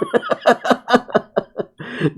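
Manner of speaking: high, rapid cackle of real laughter; real laughing fit. Real, not acted. Player cracks up at "get older", high laughing fit. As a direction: crack up in a long high giggle.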